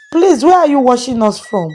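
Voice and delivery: usual speaking voice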